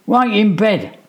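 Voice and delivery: loud, high sharp command; stern order. A narrator's reading. Mum orders the boys to bed, loud and stern. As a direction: send them straight to bed.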